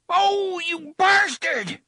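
high, loud pained yell; shout of pain. A narrator's reading. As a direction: cry out in shock and pain. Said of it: "shouted in surprise and pain".

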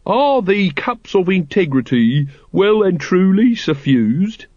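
stately, drawn-out incantation; intoning. A narrator's reading. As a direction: chant it like a lodge ritual. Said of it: "intoned Brother Watchtower".

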